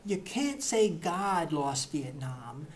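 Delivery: ordinary talk, nothing marked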